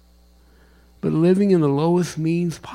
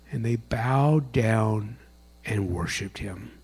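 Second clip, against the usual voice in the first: slow, soft reading; quiet; 4 semitones lower, 6 dB quieter, slower than usual. Real, not acted. Pastor reading scripture to congregation, soft and slow. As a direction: let it fall soft and slow.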